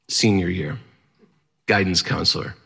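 ordinary delivery

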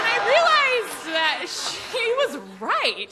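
high, slow, laughing exclaim; dawning glee, drawn out; delighted realization. Real, not acted. Teller realizing mom was right, slow high laughing glee.

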